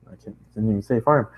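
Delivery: ordinary delivery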